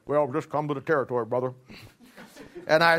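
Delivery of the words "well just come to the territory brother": imitating Ruckman. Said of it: "But this is how he said it".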